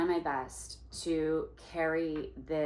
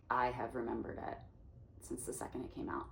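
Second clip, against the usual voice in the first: confiding whisper; 4 semitones lower, 8 dB quieter, faster than usual. Retold or imitated. Whispered aside.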